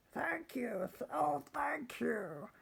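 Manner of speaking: high, breathless thanks; grateful witch. Acted. Rescued witch thanking her animal friends, high and grateful. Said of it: "the grateful witch cried".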